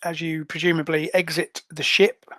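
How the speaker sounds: ordinary talk, nothing marked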